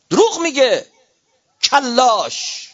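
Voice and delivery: high, loud accusing outburst; angry denunciation. Real, not acted. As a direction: hurl the accusation at full voice. Denounces false claimants from pulpit.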